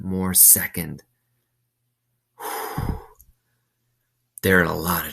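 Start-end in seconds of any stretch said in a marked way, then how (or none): 2.4-3.0 soft sigh. Real, not acted. Reader sighs before commentary.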